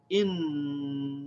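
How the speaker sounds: "innal" very slow, n and l held long; drawn-out teaching "innal"; silent hamza, connected. Shown as a demonstration. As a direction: join "innal" with no break before the l. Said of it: "we skip this Hamza al-Wasl".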